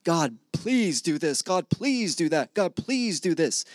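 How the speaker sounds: lightly hammed-up plea to God; mock begging. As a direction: whine it out like a needy beggar. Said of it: "you're done begging God".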